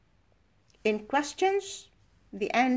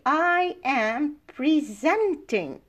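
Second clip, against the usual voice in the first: presenting as pre-ZENT-ing, slow and higher; stress on second syllable; 4 semitones higher, much slower than usual. Shown as a demonstration. Teacher demonstrating the verb, slow presenting with ZENT stressed. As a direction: stretch out presenting, leaning on ZENT. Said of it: "the stress is on the second syllable".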